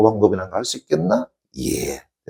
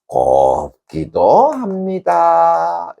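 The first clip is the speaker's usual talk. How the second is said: very slow, higher, held syllables; drawn-out reading; 7 semitones higher, much slower than usual. Shown as a demonstration. Teacher repeats the poem line for class, drawn out. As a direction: stretch each word high and long.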